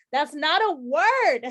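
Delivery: loud, drawn-out mock exasperation; exasperated protest. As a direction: protest it slowly and emphatically. Protesting the word bola.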